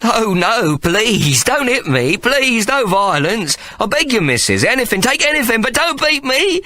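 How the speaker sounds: high, whining, terrified begging; a sniveling weasel begging not to be hit; cowardly pleading. A narrator's reading. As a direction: grovel for mercy in a high whine. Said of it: "he began to sob".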